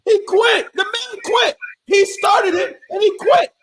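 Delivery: very high, loud hype yell; real hyped shout over the beef; excited shout. Real, not acted. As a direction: scream it like your team just won. Hyped shouting that [name] quit.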